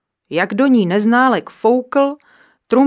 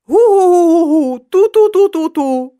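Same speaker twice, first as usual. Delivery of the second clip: blaring trumpet noise, much higher and louder; trumpet blasting; 9 semitones higher, 8 dB louder than usual. A narrator's reading. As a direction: honk it out like a clumsy trumpeter. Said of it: "popadl Neználek trumpetu a spustil".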